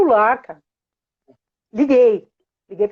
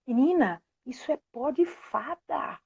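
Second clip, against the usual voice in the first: hushed secret; 9 dB quieter than usual. Retold or imitated. Mother confiding fairy secret to her daughter, hushed. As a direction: lean in and hush it like magic.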